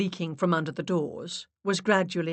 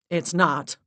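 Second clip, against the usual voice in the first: short, louder retort; snapped; 6 dB louder than usual. A narrator's reading. Max contradicting Larissa, snapped. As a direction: shut her down curtly. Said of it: "Max snapped".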